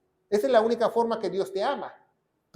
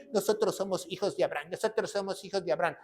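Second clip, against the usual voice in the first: quick, softer, lower mock recitation; mimicked rote boast; 4 semitones lower, 9 dB quieter, faster than usual. Preacher mimicking those claiming false paternity, quick low parroting. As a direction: rattle it off under the voice, imitating them.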